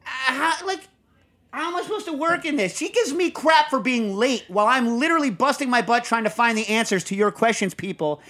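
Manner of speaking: high, loud exasperated complaining; exasperated rant. Real, not acted. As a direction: rant in mock outrage. Mock quarrel about co-host.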